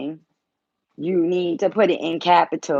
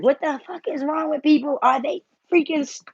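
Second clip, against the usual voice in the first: higher, mock frustrated outburst; a higher, put-on fed-up rant; imitating her own rant; 9 semitones higher than usual. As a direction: replay herself going off at people. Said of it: "And so I be like".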